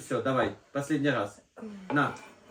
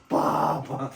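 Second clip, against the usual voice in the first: loud mimicked call for dad; imitated child's yell; 5 dB louder than usual. Father showing how Artem greets him from outside, imitated yell. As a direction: holler "papa" like an excited kid. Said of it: "він оре".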